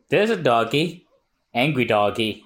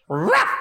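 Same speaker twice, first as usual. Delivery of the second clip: short imitated woof; spoken dog noise; dog bark; 9 semitones higher than usual. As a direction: bark back like an obedient dog. Dog answers its owner.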